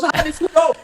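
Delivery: high, loud surprised voice; friend's hammed-up surprise; mock surprise. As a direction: act amazed he guessed. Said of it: "And she goes".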